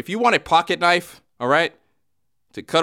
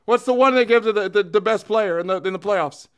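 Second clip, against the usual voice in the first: lightly put-on hockey pundit impression; a hint of blustering TV hockey pundit; Don Cherry; 6 semitones higher than usual. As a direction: rant like an opinionated hockey commentator. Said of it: "[name] doing Don Cherry".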